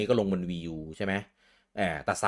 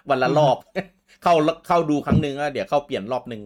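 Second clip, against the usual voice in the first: laughing; 7 semitones higher, 6 dB louder than usual. Real, not acted. Joking about changing icons daily.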